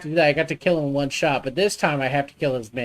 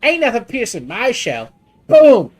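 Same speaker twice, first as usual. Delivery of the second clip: put-on loose New Jersey accent, a bit higher and louder, booming; imitating a New Jersey accent; 6 semitones higher, 7 dB louder than usual. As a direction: ham up a tough-guy New Jersey lobster. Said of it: "New Jersey-esque accent".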